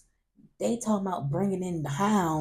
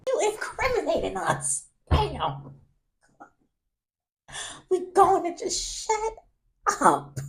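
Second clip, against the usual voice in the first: high, loud exasperated scold with a laugh; laughing while scolding; 13 semitones higher, 6 dB louder than usual. Retold or imitated. Scolding the friend who talks too much, exasperated.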